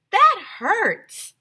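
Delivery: high loud complaining whine; hurt clown. Acted. Clown lady getting up off the floor, high loud whine.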